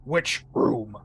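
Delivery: deep monster voice. Acted. Monster asks at elevator.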